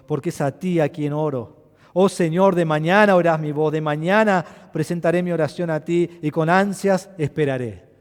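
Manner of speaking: solemn reading rising in fervor; psalm reading. Acted. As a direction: read the prayer with longing. (lectura solemne de un salmo)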